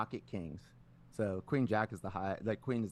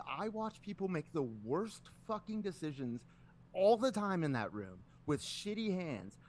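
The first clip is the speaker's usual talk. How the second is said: high-pitched indignant tirade, not shouted; angry rant; 9 semitones higher than usual. Retold or imitated. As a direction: reenact telling off a rival with rising exasperation. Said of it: "I just start getting angry".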